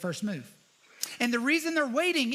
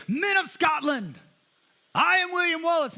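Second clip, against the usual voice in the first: rousing battle shout; 7 semitones higher, 5 dB louder than usual. Retold or imitated. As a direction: call out to the troops like William Wallace. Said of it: "and he goes".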